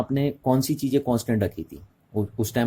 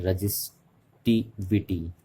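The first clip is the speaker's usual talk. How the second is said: slow emphasis; 4 dB quieter, slower than usual. Shown as a demonstration. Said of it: "ध्यान से सुनिए".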